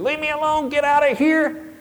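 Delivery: put-on half-asleep boy lashing out at dad; son yelling to be left alone. Sleepy son yelling at his dad to leave, high and loud. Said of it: "he was swinging and flailing at me".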